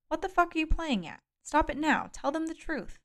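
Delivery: high-pitched angry demand; furious, incredulous. A narrator's reading. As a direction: demand it in outraged disbelief. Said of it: "I was incredulous and furious".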